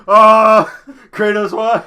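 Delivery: hammed-up betrayed wail, high and loud; mock wail. Scholar betrayed by Kratos, mock wailing. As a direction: wail it like a victim, half laughing.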